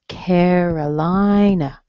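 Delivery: Carolina drawn out, CARE-uh-LIE-nuh; slow stress demo. Shown as a demonstration. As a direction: slowly stretch the strong syllables of Carolina, weak final "nuh". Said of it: "a schwa at the end".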